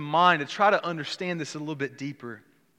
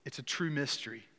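ordinary talk

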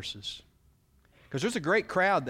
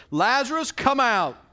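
ringing commanding call, much higher and louder; loud cry; 10 semitones higher, 9 dB louder than usual. Acted. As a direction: call the dead man out of the tomb with full voice. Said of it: "he cried out in a loud voice".

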